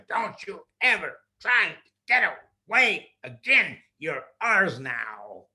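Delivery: a chilling warning; threatening clown. Acted. Clown warning the boy he is theirs, loud threat.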